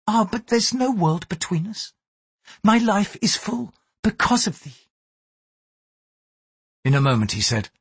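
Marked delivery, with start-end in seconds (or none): 0.1-4.9 Mariko, gentle Japanese lady. A narrator's reading. Mariko warns her lover why he is guarded, higher, earnest. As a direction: speak up in a high, earnest woman's register.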